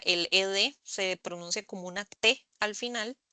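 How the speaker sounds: normal talking voice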